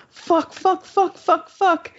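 high, squealing string of curses in pain; pained swearing. Retold or imitated. Patient curses at the nurse during the nasal swab, high and pained. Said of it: "And I start crying".

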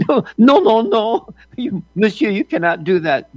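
hammed-up French protest, starting high; bad French accent. Commentator mimicking the French president's refusal, mock French accent. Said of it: "not a very good French, uh, accent".

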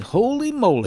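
high, loud preacher exclaim; Reverend voice. Retold or imitated. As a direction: cry it out like a preacher. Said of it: "So he says".

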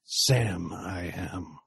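slow, low tempting villain; coaxing Dracula. Acted. Dracula tempting Sam with the food, slow and low. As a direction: lure him in slowly.

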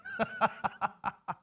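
high breathy laugh of delight; joyful laugh. Real, not acted. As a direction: laugh out in joy. Joyful laugh mid-sermon.

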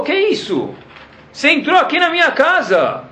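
cobrança aguda e espantada; Hashem indignado. Retold or imitated. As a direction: repreender como dono da casa ofendido. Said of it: "Hashem falou". (Hashem cobra Moshe na sua casa, agudo e indignado)

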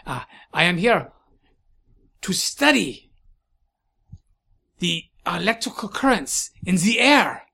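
put-on halting German-French accent, swooping on key words; accented eccentric scientist. Scientist explaining his tower research, German-French accent.

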